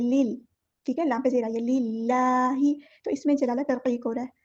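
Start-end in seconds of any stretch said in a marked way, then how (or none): 1.6-2.7 लिल्लाही slow with a thin, light लाम; thin lam. Shown as a demonstration. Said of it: "बारीक पढ़ेंगे". (लाम को बारीक पढ़ना)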